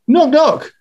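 high knock at the door voice; bickering voices in one. Shown as a demonstration. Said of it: "I'll do both voices".

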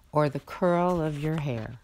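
ordinary delivery

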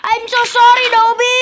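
high, loud sudden sorry; panicked apology. Real, not acted. Apologising to the panda after hitting it, high and loud. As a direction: blurt it out in alarm.

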